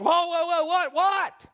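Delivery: high loud startled whoa, a voice unlike his own; startled Peter. Acted. Peter stops the babbling women with a loud startled yelp. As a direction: pull up short and yelp in disbelief. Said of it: "finally Peter's like".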